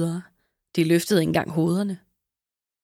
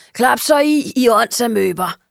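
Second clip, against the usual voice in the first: loud, higher crow's shout at the sheep; rasping yell; 4 semitones higher, 6 dB louder than usual. Acted. The crow Rodrigo yells at the noisy sheep, loud rasping shout. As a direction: snap them silent with a harsh yell. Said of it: "sagde Rodrigo og skreg skrattende".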